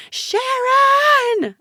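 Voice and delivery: very high, loud scream of a name; drawn-out, full-force scream. A narrator's reading. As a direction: scream desperately for attention. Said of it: "I screamed".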